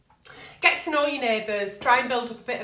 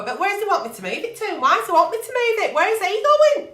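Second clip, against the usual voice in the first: rising higher and louder, fast; exasperated outburst; 8 semitones higher, 4 dB louder, faster than usual. Real, not acted. Reacting to neighbour's car message, exasperated and rising. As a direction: build into disbelief.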